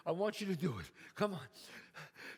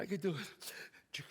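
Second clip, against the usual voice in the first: slow breathless whisper to himself; 6 semitones lower, 8 dB quieter, slower than usual. Acted. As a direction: hush it like a private prayer. Boy whispers before the throw.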